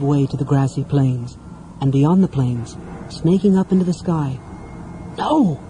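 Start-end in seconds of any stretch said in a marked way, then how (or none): 5.2-5.6 high, choked cry of disbelief. Acted. Half-elf sees the sky beyond the plains, choked. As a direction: choke it out in horror. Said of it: "Tanis choked".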